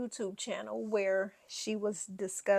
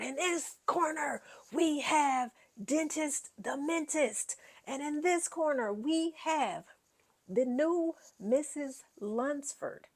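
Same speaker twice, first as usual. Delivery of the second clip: higher, slow mock boxing announcer; ring announcer; 6 semitones higher, slower than usual. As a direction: hype it like a fight-night announcer.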